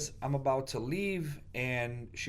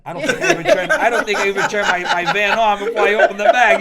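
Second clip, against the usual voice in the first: loud laughing burst, much higher; laughing excitedly; 8 semitones higher, 15 dB louder, faster than usual. Real, not acted. Telling friends about tearing open the bag, laughing loudly. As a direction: laugh it out as the hunger story peaks.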